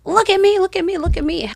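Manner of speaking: high mocking call for attention; mock attention seeking. Mocking a show-off on social media, high and needy.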